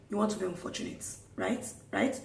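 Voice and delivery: soft grumble at another driver; imitated muttering.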